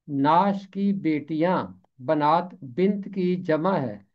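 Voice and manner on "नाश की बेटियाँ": ordinary delivery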